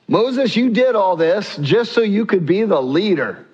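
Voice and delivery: high, whining accusation against the leader; mock-accusing complaint, high and indignant. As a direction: throw the blame at Moses, pitched well up. People accusing Moses.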